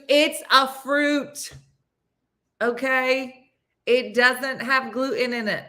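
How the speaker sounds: high, drawn-out imitation of an exasperated friend; exasperated insistence. Retelling friend insisting it's a fruit, exasperated insistence.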